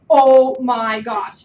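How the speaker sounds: loud, very slow, drawn-out oh my gosh; stunned exclamation. Retold or imitated. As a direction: stretch it out in disbelief. Retold stunned reaction to bonus news.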